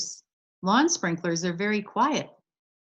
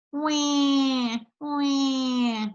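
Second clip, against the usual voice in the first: high wailing wahs; baby crying wah; 6 semitones higher than usual. Retold or imitated. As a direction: wail like a baby. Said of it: "What does that sound like?".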